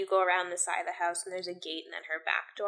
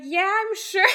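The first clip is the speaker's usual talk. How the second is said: retold insistence; 10 semitones higher, 4 dB louder than usual. Retelling friend's insistent reply.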